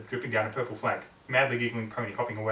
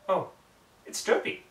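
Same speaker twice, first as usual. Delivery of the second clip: ordinary delivery, nothing marked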